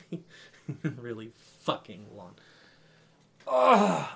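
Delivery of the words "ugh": exasperated loud ugh; annoyed ugh. Real, not acted. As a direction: groan it out, fed up. Complaining about boring winners.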